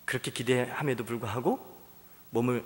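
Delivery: usual speaking voice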